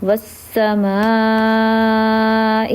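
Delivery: والسماء with the aa before the hamza held four beats; والسماء drawn out slowly on its long aa; long madd. Shown as a demonstration. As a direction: stretch the vowel in والسماء to four counts. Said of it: "چار الف کے برابر لمبا کرتے ہیں".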